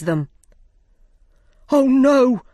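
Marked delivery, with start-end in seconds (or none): 1.7-2.4 a sudden high, loud cry of alarm; sudden shout. Acted. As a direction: blurt it out in a panic, loud and high. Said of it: "Five shouted suddenly".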